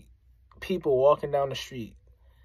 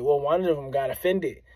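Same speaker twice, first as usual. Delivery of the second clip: normal talking voice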